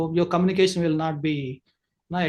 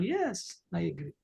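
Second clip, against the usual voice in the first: soft, falling yes I agree; nodding agreement; 10 dB quieter than usual. Shown as a demonstration. Teacher shows nodding agreement, soft and gentle. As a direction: agree gently, almost under your breath. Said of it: "you have to nod".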